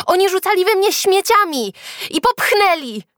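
high, loud protest; indignant shout. A narrator's reading. Julka defends herself to teacher, shouting. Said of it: "zawołała Julka".

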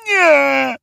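high, drawn-out sleepy refusal; mumbling pillow voice. A narrator's reading. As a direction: whine it from under the pillow. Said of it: "wybełkotała poduszka". (wysoka, przeciągła odmowa spod poduszki)

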